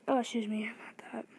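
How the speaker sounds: soft unvoiced whisper; whispered apology. Real, not acted. Gamer apologizing quietly to the viewers, whispering. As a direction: breathe the apology.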